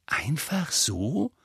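drawn-out amazed question; awed. Acted. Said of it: "sagte Ron mit ehrfürchtiger Miene". (ehrfürchtig staunend, langsam)

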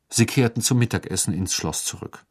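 ordinary delivery

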